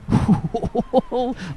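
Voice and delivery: high amused laugh. Real, not acted. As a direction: laugh at the burn. Laughing at chat's burn.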